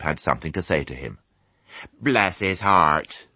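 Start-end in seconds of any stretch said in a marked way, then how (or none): 2.0-3.3 slow, lightly voiced fond sheep; a hint of a clucking sheep character; sheep crying out. A narrator's reading. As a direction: draw it out like a doting ewe. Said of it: "they cried".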